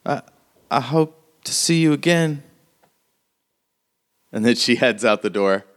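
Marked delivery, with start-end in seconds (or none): 0.0-2.4 a touch of sadness; acted sad goodbye. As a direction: say goodbye wistfully, in character. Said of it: "she looks so sad".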